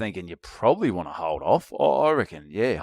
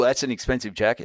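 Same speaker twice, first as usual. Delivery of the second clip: ordinary talk, nothing marked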